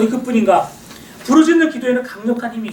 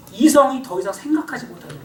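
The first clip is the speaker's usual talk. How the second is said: ordinary talk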